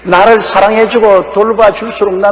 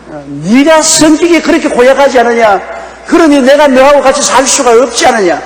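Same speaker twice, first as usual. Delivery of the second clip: higher, accusing husband's voice; blaming husband; 7 semitones higher than usual. Acted. Husband blaming his wife for his cheating, accusing. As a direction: throw the blame on the wife.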